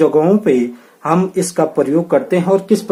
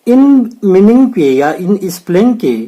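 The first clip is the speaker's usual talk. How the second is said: ordinary talk, nothing marked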